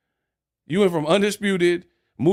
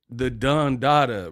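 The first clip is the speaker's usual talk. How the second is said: ordinary delivery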